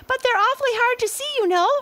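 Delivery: very high tiny monkey voices; squeaky monkeys. A narrator's reading. Six monkeys answer the gorilla, very high and squeaky. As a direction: chatter back nervously. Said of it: "said the six little monkeys".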